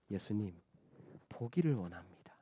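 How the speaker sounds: hushed, low, near-whispered plea; humble request. Acted. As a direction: answer very softly, almost under the breath. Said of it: "이렇게 이야기하는 거예요".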